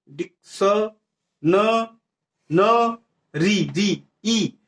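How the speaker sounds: डिक्स, न, री, ई each said separately with pauses; sounding out syllables. Shown as a demonstration. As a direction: break the word into drawn-out pieces, ending on a long ई. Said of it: "दूसरा यहाँ से आ रहा pronunciation". (डिक्स-न-री-ई को टुकड़ों में खींचकर बोलना)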